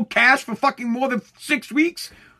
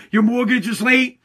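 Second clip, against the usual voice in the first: ordinary talk, nothing marked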